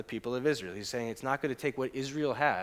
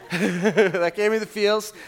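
laughing; 6 semitones higher, 8 dB louder, much slower than usual. Shown as a demonstration. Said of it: "same line said before plainly".

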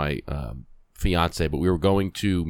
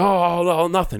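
high, flustered imitation of a guilty man; mock innocence; 10 semitones higher, 4 dB louder than usual. Heckler answering the police officers, flustered mock innocence. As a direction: play caught out and backpedaling, pitched well up.

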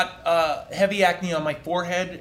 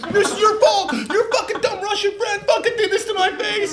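high, loud furious screaming at mom; teen tantrum; 5 dB louder than usual. Retold or imitated. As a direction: scream it at your mother like a raging teen, much higher. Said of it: "teenage shit to be like".

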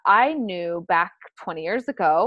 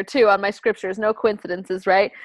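ordinary delivery

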